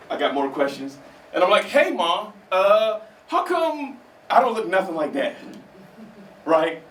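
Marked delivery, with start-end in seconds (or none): 1.6-5.3 put-on higher, slow puzzled kid voice; lightly voiced curious son; kid asking mom. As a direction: ask innocently, drawn out. Child asking his mom.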